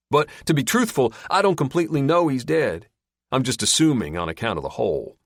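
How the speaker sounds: a lightly voiced drawling captor, amused; sly backwoods captor. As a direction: tease him with the answer. Gil, casual and amused.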